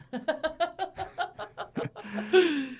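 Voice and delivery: high, breathy burst of laughter. Real, not acted. Cohost laughs at the tea accent bit, high and bursting.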